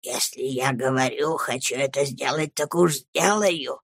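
low, soft, near-voiceless insistence; whispered resolve. A narrator's reading. Headmaster asserting his will, whispering. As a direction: press each phrase out under your breath. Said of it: "мог говорить только шёпотом".

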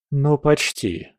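usual speaking voice

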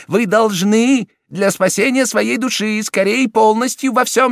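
high, loud prelate pressing for confession; urging cardinal. A narrator's reading.

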